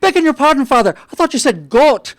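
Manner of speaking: put-on a little old man in the back pews, sheepishly sorry; sheepish old Irishman. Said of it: "with an Irish accent".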